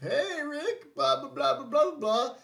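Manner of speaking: high, calling megaphone taunt; drawn-out, high mocking announcement. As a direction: imitate someone hailing over a megaphone. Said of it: "on the megaphone".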